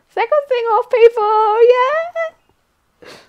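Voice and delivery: very high excited announcer call; hype shout. Real, not acted. Streamer hyping viewers for the sing-off, high and loud. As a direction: whip the crowd up.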